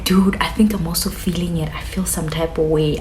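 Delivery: friend whispering that she feels it; hushed, secretive confession; imitated whisper. Friend whispering to me at the party, softly. Friend admits feeling it too.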